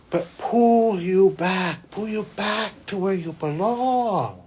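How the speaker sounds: slow and lifted, like a decree; slow solemn. Retold or imitated. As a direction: intone the mission gravely. Angel's mission retold.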